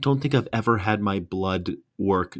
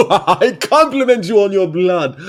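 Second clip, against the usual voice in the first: laughing through a high mock compliment; 8 semitones higher, 6 dB louder than usual. Mocking friend's blood brag while laughing. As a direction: giggle through the line.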